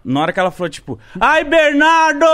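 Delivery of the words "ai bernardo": high, loud, drawn-out cry; hammed-up falsetto-like wail; imitated woman's scream. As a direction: mimic her screaming the name in passion. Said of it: "ela gritou".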